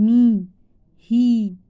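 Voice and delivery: মি, হি loud with a long "ee". Shown as a demonstration. Teacher showing long E, loud held "ee" in মি, হি. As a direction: ring out the long vowel in মি, হি. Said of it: "যখন দীর্ঘই".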